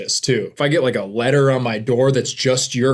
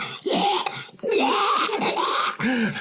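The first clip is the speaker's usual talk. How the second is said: high strangled choking sounds; hammed-up gagging squawks; mock choking noises. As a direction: imitate someone choking on food in a French-sounding gag. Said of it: "he's like".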